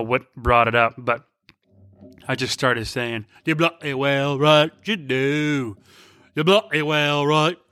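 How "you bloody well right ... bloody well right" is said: mock British accent, drawn vowels; put-on British accent. Husband quoting old song lyrics in car, mock British accent. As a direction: quote it in a hammy British accent. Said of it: "already using a bunch of accents".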